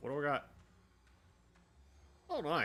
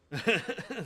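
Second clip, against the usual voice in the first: relieved laugh; 10 semitones higher, 7 dB louder than usual. Real, not acted. After a close call.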